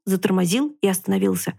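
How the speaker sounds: usual speaking voice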